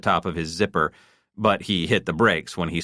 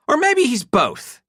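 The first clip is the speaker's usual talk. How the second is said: high, loud, sharp; loud taunt; 6 dB louder than usual. A narrator's reading. Wife taunting husband, loud. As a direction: keep getting louder. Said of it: "definitely not getting any quieter".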